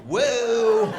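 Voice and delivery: high loud startled whoa. Retold or imitated. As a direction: jump back from the splash. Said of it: "We're like".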